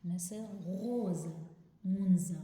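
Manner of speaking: مونزا said loudly with a clear z; z sound demo. Shown as a demonstration. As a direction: hit the z in مونزا hard.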